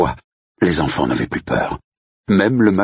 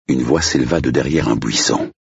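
plain reading voice

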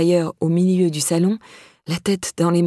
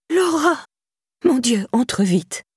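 high startled cry of the name, then slow urging; exclaiming in shock; 6 semitones higher, slower than usual. A narrator's reading. Irène seeing battered Laura at the door, shocked exclamation. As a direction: gasp her name, then pull her in. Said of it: "s'exclama Irène".

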